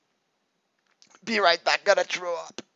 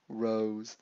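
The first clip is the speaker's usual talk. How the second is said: normal talking voice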